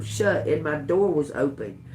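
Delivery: ordinary delivery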